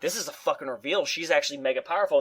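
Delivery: low, soft and quick, caught off guard; lightly voiced stunned viewer; hushed surprise. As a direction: mutter it like a twist just hit you. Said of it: "I was like".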